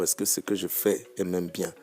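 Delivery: ordinary talk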